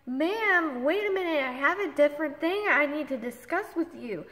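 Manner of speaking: high, loud interruption; urgent protest. Retold or imitated. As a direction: cut in hard to stop them hanging up. Pleading before agent hangs up.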